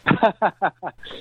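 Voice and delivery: high laugh. Real, not acted. Asked if family forgave him.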